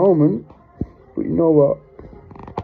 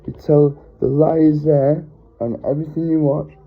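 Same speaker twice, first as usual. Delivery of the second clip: normal talking voice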